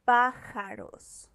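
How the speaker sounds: pájaros said slowly, stressed on PA; stress on third-to-last syllable. Shown as a demonstration. Teacher showing an esdrújula example, slow pájaros stressed on its first syllable. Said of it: "stress is on the third to last syllable".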